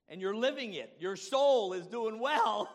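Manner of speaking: high, loud, building to a drawn-out climax; rousing pulpit shout; exultant preaching. Real, not acted. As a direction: proclaim it with mounting force.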